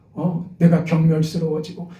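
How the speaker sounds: normal talking voice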